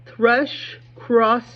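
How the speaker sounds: very slow, careful, each sound placed; slow and deliberate. Real, not acted. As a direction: take it slowly so the sh sounds come out. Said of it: "I have to really slow down and be deliberate".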